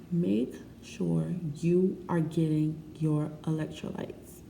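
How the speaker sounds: slow, deliberate serious warning; grave, spaced-out teaching emphasis; real serious. Real, not acted. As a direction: spell it out slowly, like a lesson. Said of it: "Let me get real serious with y'all".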